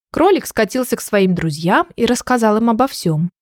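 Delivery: usual speaking voice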